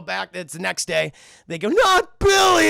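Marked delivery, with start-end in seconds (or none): 1.7-2.7 high, loud, drawn-out wail; anguished scream. Acted. Cunningham reliving his war flashback, high drawn-out scream. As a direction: howl it slowly in hammed-up anguish.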